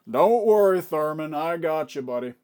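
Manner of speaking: loud opening holler, easing down to normal pitch; hulking boss hollering to his man; big henchman boss. Acted. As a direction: call out reassurance to a wounded buddy. Game master voices big Gus reassuring.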